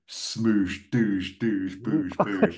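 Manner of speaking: mouthed action-movie blasts; punchy explosion noises made with the mouth; imitated explosions. Pitching a full Die Hard two sequence, mouthed explosions.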